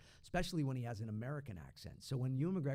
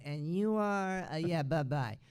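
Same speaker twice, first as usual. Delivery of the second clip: mock snooty brush-off, higher-pitched; David Spade; 4 semitones higher, 4 dB louder, slower than usual. Studio people brushing off the unexpected voice double, lightly mocking snooty voice. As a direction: dismiss them as a comic snob would. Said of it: "They turned into David Spade".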